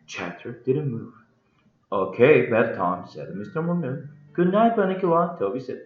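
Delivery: lively storybook reading with voiced dialogue; story narration. A narrator's reading. Harold tells of naming the rabbit, animated story narration. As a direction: read it like an animated audiobook.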